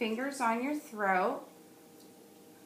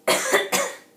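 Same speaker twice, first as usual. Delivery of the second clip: one sharp, loud cough on request; 14 semitones higher, 10 dB louder than usual. Shown as a demonstration. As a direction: cough hard once. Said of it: "cough loud".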